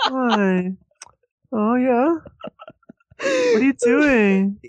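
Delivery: very high, drawn-out mock coo; lovesick baby talk. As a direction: imitate a smitten friend cooing on the phone. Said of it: "his voice went to this weirdest".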